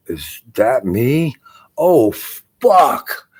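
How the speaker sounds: sudden dismay at seeing himself on TV; mock shocked realisation. Guy spotting himself on TV, shocked outburst. He's like.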